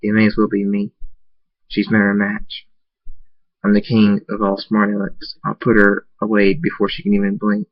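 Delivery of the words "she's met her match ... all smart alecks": plain reading voice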